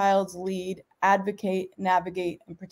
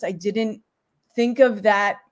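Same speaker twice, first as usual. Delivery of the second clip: normal talking voice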